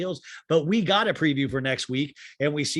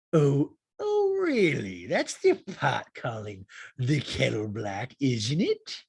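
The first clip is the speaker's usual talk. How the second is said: Rinna; much slower than usual. Retold or imitated. Housewife accusing Sutton of hypocrisy, exaggerated mock retort. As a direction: start with a squeal, then stretch each word. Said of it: "yeah, Rinna goes".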